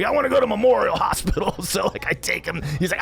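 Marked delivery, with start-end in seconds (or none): 0.0-1.5 high-pitched imitated insistence; demanding brother. Brother refusing the hospital he is taken to, high insistent imitation. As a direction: mimic his stubborn demand up high.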